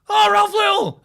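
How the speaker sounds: high, loud excited cry of a name; excited recognition. Retold or imitated. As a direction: yelp the name like a starstruck fan. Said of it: "they're like".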